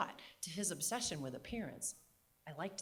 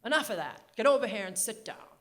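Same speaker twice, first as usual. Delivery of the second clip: loud, bossy New England voice; New England accent; 3 semitones higher, 10 dB louder than usual. Retold or imitated. Grandmother ordering grandfather to sit, loud New England accent. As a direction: command him in a regal New England accent. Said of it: "New England Katharine Hepburn accent".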